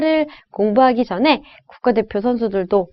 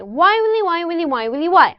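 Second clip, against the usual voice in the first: repeated "Why, Willy" stepping down from very high; rhythmic tongue twister; 6 semitones higher, much slower than usual. Shown as a demonstration. Coach demonstrates the feel for students, descending rhythmic chant. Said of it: "이 리듬".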